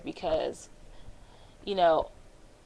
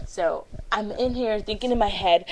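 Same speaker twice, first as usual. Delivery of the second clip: ordinary talk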